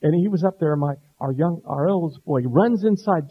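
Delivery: normal talking voice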